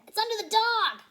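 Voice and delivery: high, loud excited crook; squeaky excited little crook; excited find. Acted. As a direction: point it out with sudden glee. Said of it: "said the short man".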